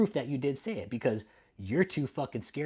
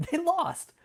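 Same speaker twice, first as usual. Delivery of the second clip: high, laughing, louder; gleeful laughing gloat; 5 dB louder than usual. Real, not acted. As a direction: crow about the win through laughter. Repeats it with a laugh.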